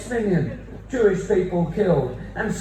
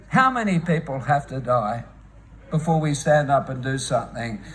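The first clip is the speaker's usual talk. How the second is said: lower, grave repeat of the question; solemn plea; 7 semitones lower than usual. Real, not acted. Speaker pleading for people in Gaza, solemn and low. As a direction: drop the pitch for a sober appeal.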